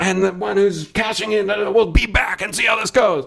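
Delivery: high-pitched hype announcer; intro announcer impression. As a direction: imitate a ring announcer hyping the fighters. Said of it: "Kind of like they do".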